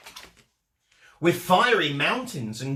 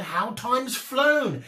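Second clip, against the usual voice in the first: put-on fond old snails; 8 semitones higher, slower than usual. A narrator's reading. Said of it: "who said".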